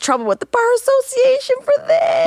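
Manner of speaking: incredulous outburst. Real, not acted. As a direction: blurt it out in disbelief. Host reacts to lawyer's misconduct.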